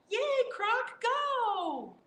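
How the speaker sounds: high cheer for Croc. Acted.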